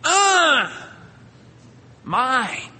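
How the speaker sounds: high, loud "Uh" into a whiny "mine."; wordless toddler. Retold or imitated. Preacher imitating a baby who can't talk yet, high and whiny. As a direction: grunt then claim it like a baby. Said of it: "if they can't speak".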